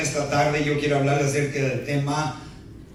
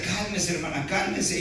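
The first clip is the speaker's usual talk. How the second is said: softer, calming words; soothing pastor; 3 semitones higher, 6 dB quieter than usual. Retold or imitated. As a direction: hush her gently. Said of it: "el pastor le decía".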